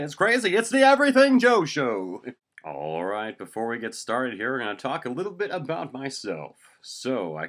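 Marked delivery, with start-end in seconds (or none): 0.0-2.2 very high, very loud hype announcer. Acted. Host hypes his own show, big announcer voice. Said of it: "talks in this announcer voice".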